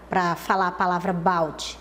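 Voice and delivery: usual speaking voice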